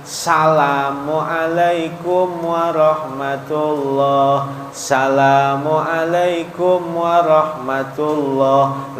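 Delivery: slow, stretched "Assalamualaikum" lacking its first syllable; imam's salam without "as". Acted. Imam ending prayer wrongly, slow chanted "Assalamualaikum" without as-. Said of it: "Kalau dia jadi imam".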